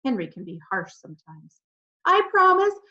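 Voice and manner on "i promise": much higher and louder wail. A narrator's reading. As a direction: plead in a wail. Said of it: "wailed Denise".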